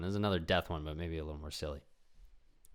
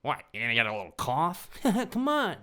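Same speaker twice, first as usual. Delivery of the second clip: lightly voiced taunt, higher; mocking jock; 7 semitones higher than usual. Jock mocking the friend's fear of a cough, light taunt. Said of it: "teasing jock again".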